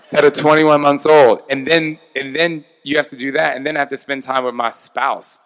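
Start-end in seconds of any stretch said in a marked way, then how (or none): 0.1-1.4 laughing. Real, not acted. Laughs about toddler twins.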